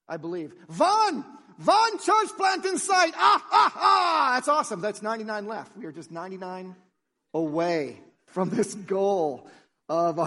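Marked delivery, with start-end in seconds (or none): 0.7-4.3 loud, high Sesame Street vampire count; booming accented count with a counting laugh; Count Von Count. Acted. Preacher quoting a Sesame Street philosopher, in a high, shouted puppet-vampire voice. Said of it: "Count Von Count".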